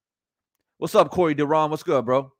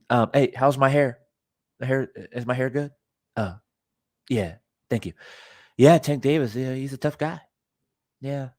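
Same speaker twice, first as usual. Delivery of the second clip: Ryan Garcia; 3 semitones lower than usual. Retold or imitated. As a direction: lightly imitate his hesitant, low-key talk. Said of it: "can talk like this".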